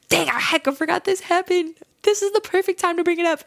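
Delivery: sudden realization. Real, not acted. Host to listeners on suddenly remembering the story, pleasantly struck.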